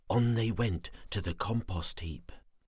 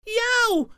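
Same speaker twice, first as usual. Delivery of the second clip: sudden high yelp; 9 dB louder than usual. Acted. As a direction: yelp out in pain. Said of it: "he yelped".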